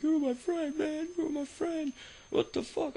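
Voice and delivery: very high, loud, tearful wail; imitating the armed kid's sobbing breakdown; panicked crying plea. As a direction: sob it out desperate and unhinged. Armed kid freaking out, crying.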